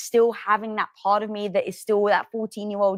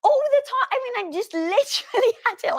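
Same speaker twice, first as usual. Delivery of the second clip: much higher emphatic agreement; 13 semitones higher than usual. Real, not acted. Guest eagerly agrees with host, high voice.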